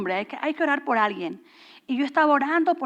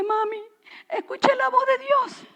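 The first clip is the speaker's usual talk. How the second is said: much higher, retold tearful cry; imitated tearful child; 10 semitones higher than usual. Daughter tells mother she heard God, imitated tearful. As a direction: mimic an overwhelmed child in a high voice. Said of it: "la veo que está llorando".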